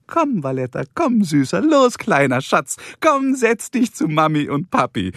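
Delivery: high, sing-song coaxing; cooing to a pet. Acted. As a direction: coax a little dog to come sit. Carrie coaxes her little dog.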